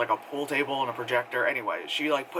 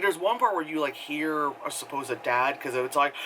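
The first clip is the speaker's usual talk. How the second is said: ordinary delivery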